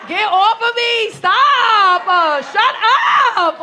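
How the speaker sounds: loud laughing "get off of me"; laughing protest. Real, not acted. Laughing loudly at the crowd teasing her.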